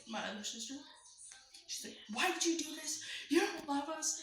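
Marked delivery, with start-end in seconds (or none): 2.1-4.2 higher, louder accusing yell at her sibling; mock the sister's hurt, shrill accusation. The other sister yelling at the narrator, higher and louder mock yell. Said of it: "my sister yells at me".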